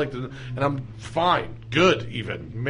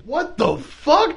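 slow, high, drawn-out disbelief; stunned disbelief; 12 semitones higher, much slower than usual. Retold or imitated. Viewer watching a rolling rally crash, slow stunned disbelief. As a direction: stretch it out, baffled. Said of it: "And I'm like".

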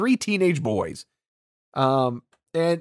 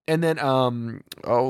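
ordinary talk, nothing marked

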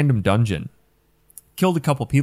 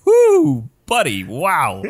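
excited whoop; 10 semitones higher, 6 dB louder, much slower than usual. Retold or imitated. Reacting to the sultry voice.

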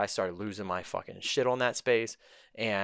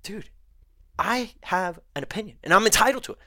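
indignant thought; 8 semitones higher, 4 dB louder than usual. Retold or imitated. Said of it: "I'm just thinking to myself".

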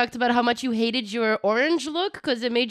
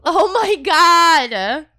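amazed; 5 semitones higher, 8 dB louder, much slower than usual. Real, not acted. Smoke contraption lights up.

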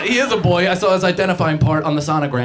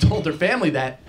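ordinary delivery

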